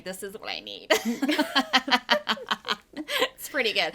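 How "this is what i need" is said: mock excited. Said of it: "super excited and be like".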